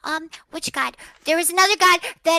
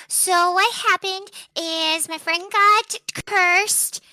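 normal talking voice

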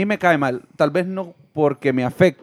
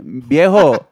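ordinary talk, nothing marked

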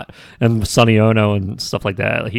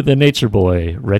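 normal talking voice

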